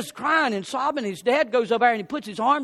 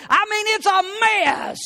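exasperated cry; 11 semitones higher, 3 dB louder than usual. Retold or imitated. Boy sees ruined table.

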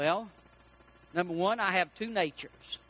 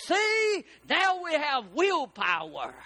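high, drawn-out "see", then slower; triumphant; 6 semitones higher, slower than usual. Acted. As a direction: proudly show it off, voice leaping up. Frog triumphs in acted story.

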